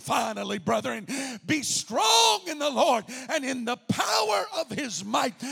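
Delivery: shouted quote. Shown as a demonstration. Preacher proclaiming the verse, loud. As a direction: shout the scripture out. Repeated with force.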